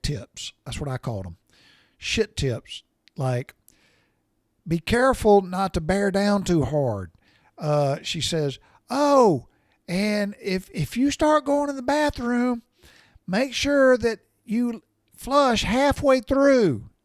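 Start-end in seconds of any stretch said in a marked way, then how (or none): 4.7-7.1 higher, louder called-through-the-house advice; wife hollering advice. Retold or imitated. Wife calling advice to husband in bathroom, high and loud. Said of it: "she's hollering loud enough". 8.9-16.9 high, loud, slow hollered instructions; wife hollering. Retold or imitated. Wife shouting bathroom tips across the house, high slow holler. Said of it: "she's hollering loud enough".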